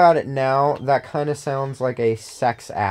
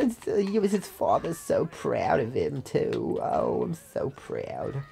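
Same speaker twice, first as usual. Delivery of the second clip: breathy proud parent; 8 dB quieter than usual. Pretend. Player cooing proudly over his summoned minion as a doting parent.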